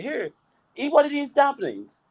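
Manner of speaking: high-pitched imitation of Indonesian coworker; high, insistent coworker voice. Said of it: "some of the sentences come out funny".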